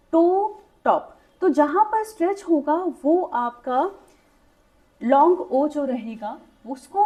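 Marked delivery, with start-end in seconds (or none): 0.1-1.1 टो drawn out slowly, टॉप cut short and quick; long o then short o. Shown as a demonstration. Teacher contrasting long and short o for students, slow stretched टो then quick टॉप. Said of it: "आप जल्दी बोलते हैं टॉप".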